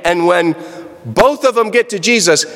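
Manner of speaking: ordinary talk, nothing marked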